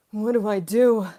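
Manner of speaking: loud, hammed-up cry of confusion; mock despair. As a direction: burst out loudly, playing utterly lost. Mock panic at being confused.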